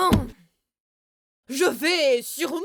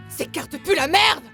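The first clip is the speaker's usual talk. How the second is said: angry outburst; 4 semitones higher, 6 dB louder than usual. Acted. Cursing the bad cards.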